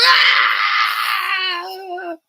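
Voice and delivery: long, loud high-pitched mock sob; hammed-up falsetto crying howl; bawling wail. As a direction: wail it out at full cry. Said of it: "And she starts bawling".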